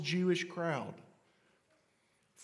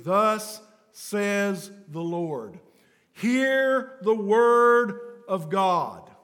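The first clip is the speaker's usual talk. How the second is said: slow, drawn-out prophet's call, half sung, getting louder; prophet proclaiming; 4 semitones higher, 12 dB louder, much slower than usual. Retold or imitated. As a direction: intone it like an Old Testament prophet before Israel. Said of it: "Old Testament prophets standing before Israel and saying".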